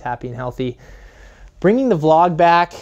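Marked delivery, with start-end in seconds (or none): 1.6-2.7 excited announcement. Real, not acted. Announcing the vlog's return.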